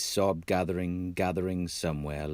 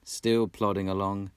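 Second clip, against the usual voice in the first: ordinary delivery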